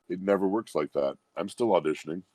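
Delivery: usual speaking voice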